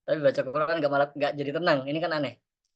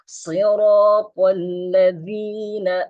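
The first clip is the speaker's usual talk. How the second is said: slow, higher chanted tilawah; Quran recitation; 4 semitones higher, much slower than usual. Shown as a demonstration. Teacher recites the Fatihah verse for the class. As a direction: recite slowly and calmly.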